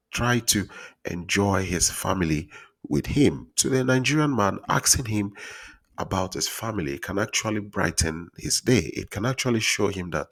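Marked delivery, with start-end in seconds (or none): none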